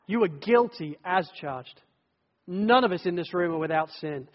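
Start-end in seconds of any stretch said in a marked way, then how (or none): none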